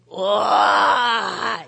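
long, drawn-out emotional cry; real welling-up exclamation; tearful whoa. Real, not acted. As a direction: let out an overwhelmed wail of wonder. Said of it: "oh my gosh, I get tears".

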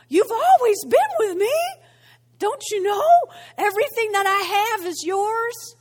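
high, loud, incredulous reassurance; insistent appeal. Acted. Father insists to his son, loudly incredulous. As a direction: press it home, pitched right up.

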